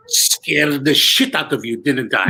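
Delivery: slow teasing line in a light mock Indian accent. Magician teasing the shocked audience, slow mock Indian accent. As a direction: lean into a hammed-up Indian accent, savoring the punchline. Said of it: "and then he would say".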